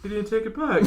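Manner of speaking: high mock voice; squeaky put-on voice; acted quote. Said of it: "I was like".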